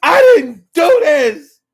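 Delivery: high, loud, slow mock-sobbing denial; imitating tearful denial. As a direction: wail it out like a cornered crying man. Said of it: "he was saying".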